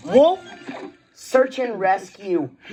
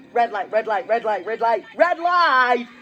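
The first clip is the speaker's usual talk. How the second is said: mounting alarm. Acted. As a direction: rattle it off, then cry the last one. Warning the driver, growing urgent.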